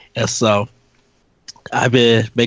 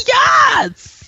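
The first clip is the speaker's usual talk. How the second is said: much higher, delighted shout; excited cheer; 6 dB louder than usual. Real, not acted. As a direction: cheer with pure joy. Cheering at the shown GameCube.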